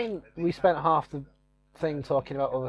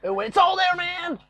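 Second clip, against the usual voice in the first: much higher, wild ranting cult-leader voice; Charles Manson; 14 semitones higher, slower than usual. Pretend. A cult leader shouting at his accusers in a high wild rant. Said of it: "Might talk like Charles Manson".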